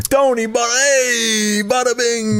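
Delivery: high hammed-up Italian New York accent; Italian mobster voice. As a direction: bada bing like a cartoon Italian mobster. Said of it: "surprised I didn't do an Italian voice".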